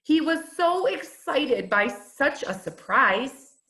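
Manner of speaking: usual speaking voice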